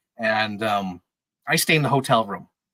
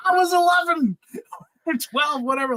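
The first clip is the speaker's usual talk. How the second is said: much higher mock yell with a laugh; retorting to a Twitter stranger, laughing shout; exasperated shout; 13 semitones higher than usual. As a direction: throw it back at him, half laughing. Arguing with a Twitter user.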